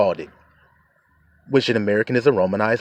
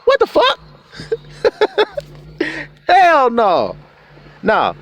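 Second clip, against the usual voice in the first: far higher, louder, laughing; outraged disbelief; 4 dB louder, slower than usual. Real, not acted. Preacher reacting to a renamed friend, outraged. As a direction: blow up in mock outrage.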